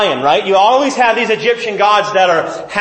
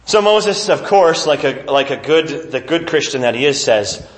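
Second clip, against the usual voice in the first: ordinary talk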